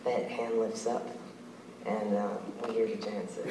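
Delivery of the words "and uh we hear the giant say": ordinary delivery, nothing marked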